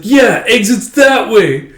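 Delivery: high, slow mocking boy; smug sidekick kid. Sidekick boy mocking Anya toward the exit, high slow. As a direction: point the way out with smug relish.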